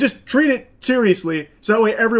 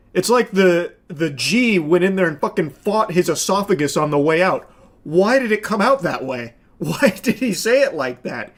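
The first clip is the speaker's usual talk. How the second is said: usual speaking voice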